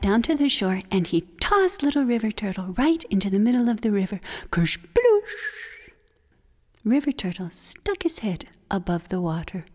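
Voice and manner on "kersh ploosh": splash. Acted. Splash sound effect.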